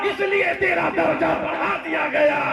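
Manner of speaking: high, relentless rhetorical crescendo; full-force preaching. Acted. Unseen voice answering the dead man, preached at full pitch. Preaches climax in heavenly reply.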